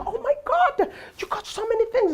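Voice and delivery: high-pitched mock dismay at a customer; shocked counter lady. Freaked-out counter lady, acted.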